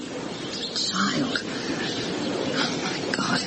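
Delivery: the breath goes out of her; shocked gasp. Acted. Housekeeper stunned when asked about the ghost girl, gasping.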